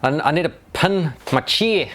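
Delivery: put-on light New Zealand accent, pen said as pin; lightly put-on Kiwi voice; a hint of New Zealand accent asking for a pen. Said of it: "you're quite good at the Kiwi accent".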